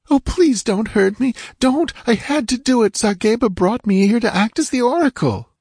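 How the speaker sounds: put-on high, pleading girl's voice near sobs; hysterical pleading. A narrator's reading. Terrified captive girl begs Conan for mercy, high and pleading. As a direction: beg him not to hurt you. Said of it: "her sobs quivered with a note of hysteria".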